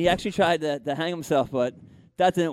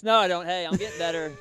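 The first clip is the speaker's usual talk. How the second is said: ordinary talk, nothing marked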